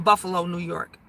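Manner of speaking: normal talking voice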